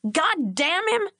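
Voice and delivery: high, slow furious oath; furious curse. A narrator's reading. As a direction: spit out the curse in rage. Bobby shoots to his feet.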